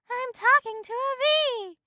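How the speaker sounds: high, loud disbelief; shocked woman. A narrator's reading. Woman realizes she talks to a bee, shrill shock. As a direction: squeal it in amazement.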